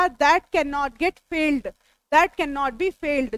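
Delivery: very slow, words drawn apart; slow dictation. Shown as a demonstration. Teacher giving the English meaning, very slow. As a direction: spell it out slowly for notes. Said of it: "अब इसको English में पूछा जाएगा".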